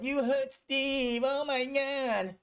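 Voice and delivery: put-on high, slow whiny child voice; mock the crying kids, high and drawn out; upset kids. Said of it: "And the kids were like".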